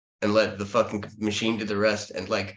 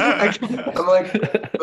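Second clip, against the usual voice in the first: laughing; 10 semitones higher, 5 dB louder, much slower than usual. Real, not acted. Laughing at losing his thought.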